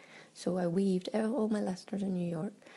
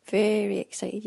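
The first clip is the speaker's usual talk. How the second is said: louder, eager and bright with excitement; very excited; 7 dB louder than usual. Real, not acted. Excited before the flight, telling her audio diary. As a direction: let the thrill of the trip spill out. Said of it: "you probably hear it in my voice".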